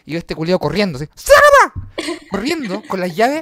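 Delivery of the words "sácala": very high, loud panicked shout; nervous cousin yelling as he runs; frantic yell. Retold or imitated. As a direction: yell it while running for the gate. Tito yelling while running.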